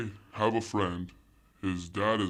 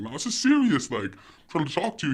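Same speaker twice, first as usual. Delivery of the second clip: high, louder retold pleading; insistent protest; 8 semitones higher, 5 dB louder than usual. Child trying to talk seriously to joking dad, insistent. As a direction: insist on being taken seriously.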